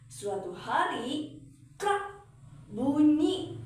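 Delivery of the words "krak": a sharp high krak, like wood cracking; little spoken snap of a breaking comb; snapping sound. Acted. Comb snaps in the story.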